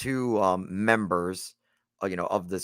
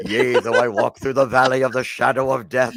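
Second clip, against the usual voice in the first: loud dramatic spoken-word recitation; William Shatner spoken word; 4 semitones higher, 7 dB louder than usual. Pretend. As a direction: declaim it like a ham actor. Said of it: "I was doing the William Shatner, you know, spoken word".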